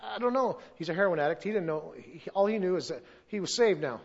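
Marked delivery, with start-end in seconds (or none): 0.0-0.6 high, halting imitated helplessness; retold terrified stammer. Frightened new convert admits he is lost, imitated stammer. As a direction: stumble on the start, imitating someone lost. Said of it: "He's terrified".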